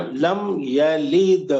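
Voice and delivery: slow لم یلد with a bounced دال; qalqala. Shown as a demonstration. Teacher reciting with qalqala for the student. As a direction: end یلد on an echoing "d". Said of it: "قلقلہ".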